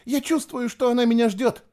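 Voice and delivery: very high, hysterical insistence; panicked cry. A narrator's reading. Girl sensing her drowned sister waiting, panicked. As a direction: keep crying out in high-pitched panic.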